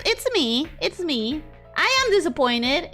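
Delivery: ordinary talk, nothing marked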